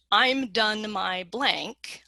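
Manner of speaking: slow, deliberate template reading; slow example frame. Shown as a demonstration. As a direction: spell out the pattern slowly. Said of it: "In the Mid-Atlantic and in Canadian English".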